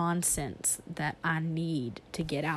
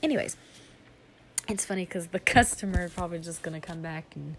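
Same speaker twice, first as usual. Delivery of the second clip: ordinary talk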